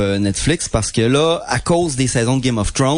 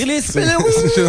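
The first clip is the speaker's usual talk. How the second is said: high squeaky. Pretend. Mocking cheap Chinese knockoff name.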